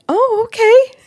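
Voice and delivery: a startled, high-pitched okay; high surprised okay. Shown as a demonstration. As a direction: squeak it out in surprise. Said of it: "Like this".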